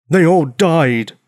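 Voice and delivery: loud, slow furious roar; angry giant yell. Acted. Grieving giant snapping at the girl, loud roar. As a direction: roar it at her in anger. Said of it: "He yelled loudly and quickly".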